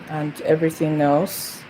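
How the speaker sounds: usual speaking voice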